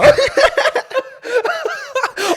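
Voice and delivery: can't hold the laugh in; high squealing laugh. Real, not acted. Laughing at the friend's crime-scene text, high squeal. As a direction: burst into a shrill high laugh.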